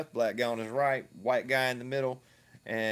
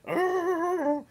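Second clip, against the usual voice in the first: very high-pitched imitation scream; mock scream; 4 dB louder than usual. Man mimics the vape shop employee screaming, mock shriek. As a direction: screech in mockery of someone's tantrum. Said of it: "With him screaming like".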